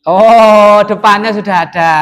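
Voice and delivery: loud, long "oh" of understanding; sudden realisation. Real, not acted. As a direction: exclaim as it dawns on you. Sudden realisation after student's explanation.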